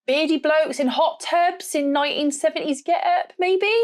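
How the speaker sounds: a hint of West Country farmer, pitched high; southern English farmer's accent. Real, not acted. Photographer telling suppliers what she shoots, farmer's accent slipping out. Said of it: "That's my accent coming out then".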